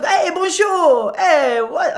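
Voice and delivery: high, drawn-out mock French; mimicked French accent. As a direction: play at being French to a child. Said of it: "it's okay to mimic some accents".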